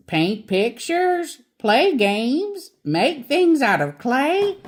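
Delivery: a hint of a hearty kindergarten teacher; cheerful teacher. A narrator's reading. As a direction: list it all brightly like a kindly teacher. Teacher bear listing activities.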